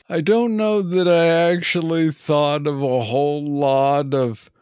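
ordinary talk, nothing marked

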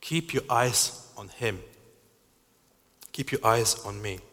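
ordinary delivery

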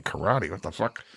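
incredulous aside. Retold or imitated. Disbelief, dropping to near whisper.

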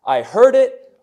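loud, high punch on heard; officer's emphatic copy that; emphatic retelling. Retold or imitated. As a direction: hit "I heard it" hard and high. Preacher voicing officer's emphatic line.

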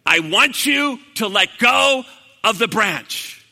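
loud, drawn-out command; slow emphatic repeat. Retold or imitated. God repeating the order to Jack, slow and emphatic. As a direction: spell it out slowly and forcefully.

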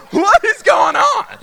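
high, drawn-out mock-terrified yell; panicked shout. Intruder busts in with a knife, panicked acted shout. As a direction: holler it in acted panic, stretching the words.

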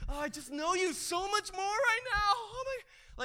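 high-pitched mock rapture; gushing devotee. Worshipper alone with Oceans on headphones, mock gushing. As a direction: gush it out, hammed-up and high.

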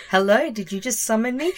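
hammed-up playful back-and-forth; playful mock dialogue. Mimicking Siri exchange, laughing.

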